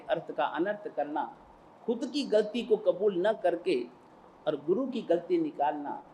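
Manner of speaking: ordinary talk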